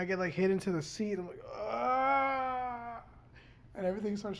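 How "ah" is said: high little startled yelp; a sudden high cry as you're jolted. Retold or imitated. Thrown into seat by impact.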